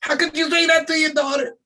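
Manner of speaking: high, hammed-up outraged parent; hammed-up scandalised parent voice; mock shocked parent. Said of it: "some parents will probably be like".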